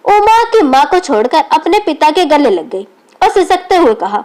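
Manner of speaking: ordinary delivery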